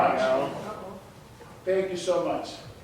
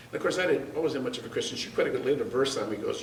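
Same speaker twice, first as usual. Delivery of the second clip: normal talking voice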